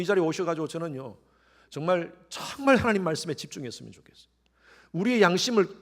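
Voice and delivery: ordinary delivery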